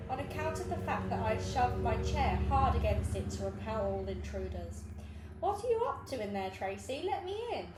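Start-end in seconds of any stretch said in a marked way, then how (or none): 5.4-7.7 raised voice through a door; calling through the door. Acted. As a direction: call out to someone locked in. Jenny calling through blocked door.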